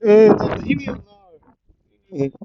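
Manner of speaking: loud, high flustered shout, last words slow; flustered yelling denial; embarrassed yell. Retold or imitated. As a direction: yell it out, red as a tomato. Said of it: "yell out and say".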